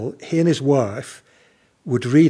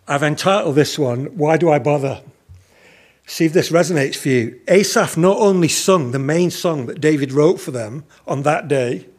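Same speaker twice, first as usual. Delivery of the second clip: usual speaking voice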